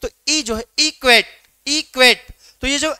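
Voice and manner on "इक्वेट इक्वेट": "Equate" with "क्वेट" punched louder; "equate" in two beats, weak then strong; stress on second syllable. Shown as a demonstration. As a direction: lighter "इ", then hit "क्वेट" hard and let it fall. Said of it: "ये हो जाएगा आपका stressed syllable".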